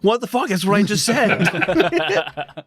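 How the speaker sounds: high, slow laughing disbelief; mock disbelief. Laughing to friends at the game repeating him, high mock disbelief. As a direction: stretch it out in amused shock. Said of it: "And I'm like".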